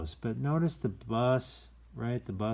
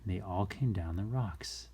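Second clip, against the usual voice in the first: plain reading voice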